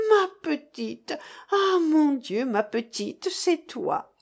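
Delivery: mother seeing her daughter home at last; mother's cry of relief. Acted. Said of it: "s'écria madame de Staël".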